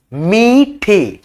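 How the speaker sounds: मीठे drawn out very slowly, syllable by syllable; word stretched slowly. Shown as a demonstration. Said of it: "ठीक है, ऐसे लिखेंगे".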